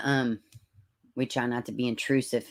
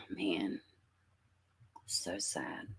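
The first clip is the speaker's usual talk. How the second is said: whispered; 8 semitones lower, 15 dB quieter, much slower than usual. Real, not acted. Reacting sadly to a chat comment.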